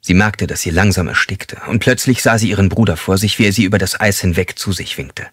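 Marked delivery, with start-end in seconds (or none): none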